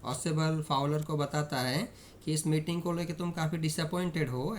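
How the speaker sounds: normal talking voice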